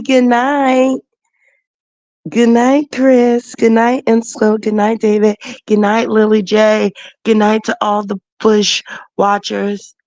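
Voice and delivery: cheerful sign-off. Real, not acted. Sign-off shout-outs to viewers.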